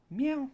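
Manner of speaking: high meow; imitated meow. Shown as a demonstration. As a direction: meow up high to coax her. Meowing at the cat.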